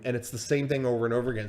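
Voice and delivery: usual speaking voice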